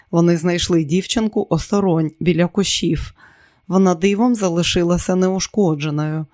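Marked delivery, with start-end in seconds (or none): none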